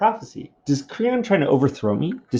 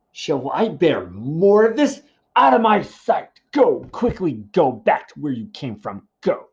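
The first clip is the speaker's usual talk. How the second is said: angry dismissal; 2 semitones higher than usual. Acted. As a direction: throw the prophet out in fury. Oedipus ordering Tiresias away.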